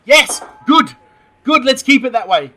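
loud, high burst of relief; emphatic approval. Real, not acted. Host welcomes his club's players being left out, emphatic approval. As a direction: punch it out loudly, delighted to agree. Cheering the squad omissions.

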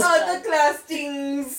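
goofy drawn-out voice. Pretend. Drawn-out mocking of name.

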